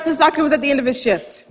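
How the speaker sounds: ordinary talk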